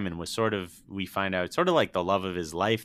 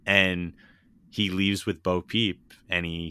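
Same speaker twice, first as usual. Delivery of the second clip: usual speaking voice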